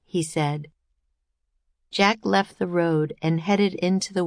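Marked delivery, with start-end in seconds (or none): none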